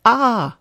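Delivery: a sudden high loud yelp; sharp cry of pain. Acted. Dylan crying out as the crop hits him, sharp yelp. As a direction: jolt out a pained cry as the crop lands.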